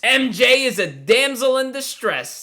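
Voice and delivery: high-pitched announcer-like proclamation; mock-excited reveal. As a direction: proclaim the cliché with false excitement. Mock-excited reveal of tired trope.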